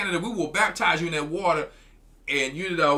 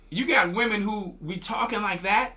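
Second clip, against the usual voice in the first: ordinary delivery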